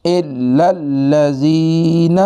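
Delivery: slow, high, chanted tajweed; slow melodic Quran reading; Quranic recitation. Shown as a demonstration. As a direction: recite الا الذین with both shaddas. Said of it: "بلکہ direct پڑھیں گے".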